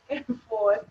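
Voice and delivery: ordinary delivery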